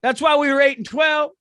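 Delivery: mock proud coach. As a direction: puff up like a losing coach bragging. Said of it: "What, 'cause you're losing?".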